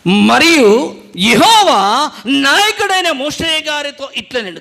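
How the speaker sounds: slow, high, loud drawn-out reading of the verse; solemn scripture reading. Acted. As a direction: intone the verse slowly like holy writ. Pastor reads scripture verse aloud.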